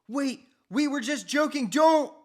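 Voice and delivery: high loud yell to stop him; boy yelling at his uncle to stop, high and loud; panicked shout. Acted. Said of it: "Chucky shouted".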